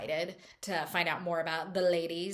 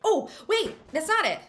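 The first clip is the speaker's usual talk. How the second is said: high, louder self-interruption; sudden remembering; 9 semitones higher, 5 dB louder than usual. Real, not acted. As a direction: cut yourself off, suddenly remembering. Suddenly remembers something forgotten.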